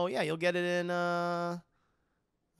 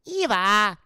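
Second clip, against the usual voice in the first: robot call; 4 semitones higher, 10 dB louder than usual. Retold or imitated. Imitating Wall-E robot calling Eve.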